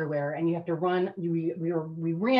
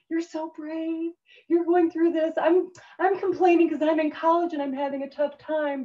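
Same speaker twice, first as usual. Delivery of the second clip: high, gushing voice, louder as it goes; gushing friends' letters; 10 semitones higher, 4 dB louder than usual. Retold or imitated. Friends writing to the recruit from college, high and gushing. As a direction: imitate admiring friends in a high voice.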